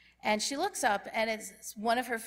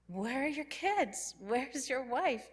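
higher, slow, puzzled questions; puzzled surprise; 5 semitones higher, 3 dB quieter, slower than usual. Retold or imitated. Opening the door to Ed alone, surprised. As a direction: ask slowly, taken aback.

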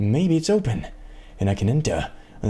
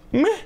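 high sharp gasp then a grumble; startled gasp; 5 dB louder than usual. Real, not acted. Climbing gamer slips again, gasp then grumbled meh. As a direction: gasp at the slip, then shrug it off. Near fall while climbing.

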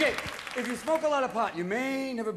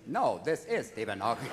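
low, robotic correction; monotone computer voice on the phone; Stephen Hawking; 10 semitones lower than usual. Retold or imitated. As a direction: imitate a speech synthesizer insisting. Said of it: "I once called Stephen Hawking's house".

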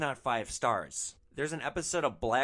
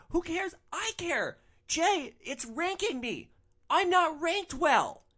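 much higher, whiny, outraged mock voice; mock spoiled girlfriend; 5 dB louder, slower than usual. Driver imitating Natalie pleading to her boyfriend Jay, high whiny mock outrage. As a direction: squeal it like a spoiled rich girl in disbelief.

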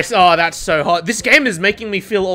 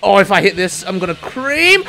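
usual speaking voice

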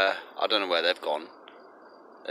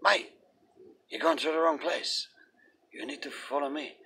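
higher mock voice of a street guy; dealer redirecting; 7 semitones higher, 3 dB quieter than usual. Stranger steering the buyer elsewhere, mock high voice. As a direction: lead him off, pitched up. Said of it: "some guys come up to me".

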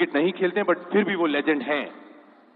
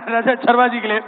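loud, raised emcee voice; announcer's applause call; 5 semitones higher, 8 dB louder than usual. Real, not acted. Host names the guest to be applauded, in a loud announcing shout. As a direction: proclaim the guest's name to the crowd.

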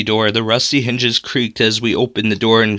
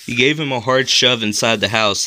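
ordinary delivery

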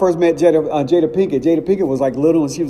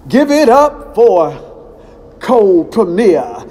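high, slow hype-man intro; announcer voice; 6 semitones higher, 4 dB louder, slower than usual. Retold or imitated. MC hyping up the next act, drawn-out announcer call. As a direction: stretch it out like an MC bringing an act on. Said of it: "and there's this guy".

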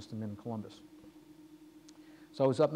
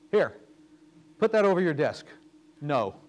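louder and higher, handing over the note; firm mentor's command; 3 semitones higher, 9 dB louder than usual. Retold or imitated. Mentor Dave telling Ray to say no, firm loud command. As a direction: plant the word no like an order.

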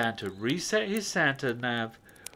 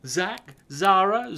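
plain reading voice

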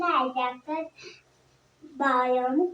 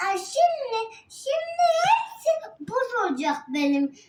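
high whiny crying voice; whining; 7 semitones higher, 4 dB louder than usual. Real, not acted. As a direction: whine it out near tears. Whining, cry heard.

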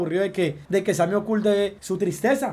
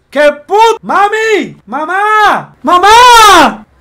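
screams "Mamá!" much higher and far louder, faking terror; panicked scream for mom; 8 semitones higher, 14 dB louder, much slower than usual. Pretend. Son fakes panic to prank his mother, screaming in mock terror. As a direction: yell for your mother as if something awful just happened.

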